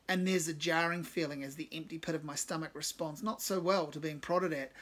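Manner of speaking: usual speaking voice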